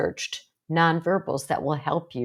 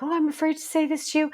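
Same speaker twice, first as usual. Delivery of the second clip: high, fast, nervous quote; 9 semitones higher, faster than usual. Shown as a demonstration. Counselor mocking a nervous speaker, high and quick. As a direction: sound timid and scared of the listener. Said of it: "So I don't wanna sound nervous".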